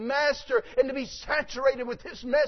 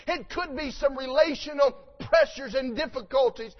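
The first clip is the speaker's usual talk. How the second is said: usual speaking voice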